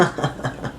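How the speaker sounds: quick embarrassed chuckle; short breathy laugh. Real, not acted. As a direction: laugh it off softly. Laughs after the slip.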